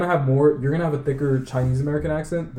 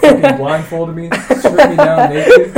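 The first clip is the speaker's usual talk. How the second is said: laughing; 10 semitones higher, 12 dB louder, slower than usual. Real, not acted. Exaggerated laughing hypothetical.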